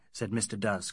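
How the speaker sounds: usual speaking voice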